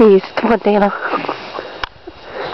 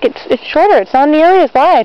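higher and louder, insisting; insistent; 6 semitones higher, 3 dB louder than usual. Real, not acted. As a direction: argue the path really is narrower. Insists, pitch jumps up.

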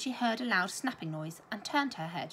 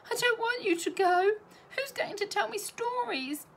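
put-on wailing little girl; 12 semitones higher, 4 dB louder than usual. A narrator's reading. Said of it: "she wailed".

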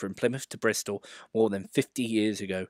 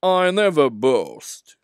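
slow, louder, higher boast; mock-modest Gordon; 5 semitones higher, 8 dB louder, much slower than usual. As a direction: boast while claiming not to. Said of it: "Gordon continued modestly".

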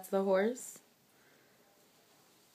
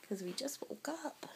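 whispering; 3 semitones higher, 10 dB quieter than usual. Real, not acted. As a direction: drop to a whisper. Soft aside to toddler.

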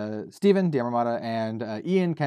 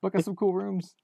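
high pleading request; pleading ask; 8 semitones higher, 3 dB quieter than usual. Pretend. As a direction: sweetly beg a friend for rooms. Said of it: "we could be like".

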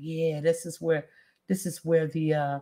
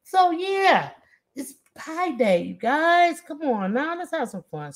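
excited; 8 semitones higher than usual. Real, not acted. Cheering on viewers, pie day.

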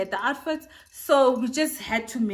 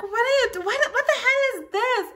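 high, loud, half-laughing outrage; shocked disbelief; 14 semitones higher, 5 dB louder than usual. Retold or imitated. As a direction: squeal it like she can't believe her eyes. Snob girl reacts in disbelief.

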